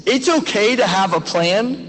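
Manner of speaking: ordinary talk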